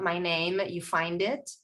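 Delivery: ordinary talk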